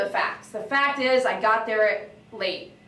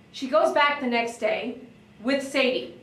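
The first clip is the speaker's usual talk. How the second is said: ordinary delivery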